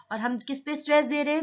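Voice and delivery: ordinary talk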